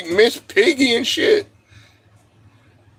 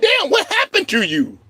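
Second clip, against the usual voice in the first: high-pitched incredulous reaction; exaggerated disbelief; mock shock; 9 semitones higher than usual. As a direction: act stunned, pitch raised. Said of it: "I'm like".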